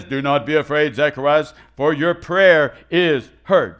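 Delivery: loud, slow, drawn-out heavenly proclamation; booming angel voice. Acted. Preacher repeating Gabriel's words, loud and slow. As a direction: thunder it like an angel announcing.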